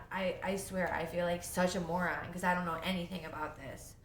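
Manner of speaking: ordinary delivery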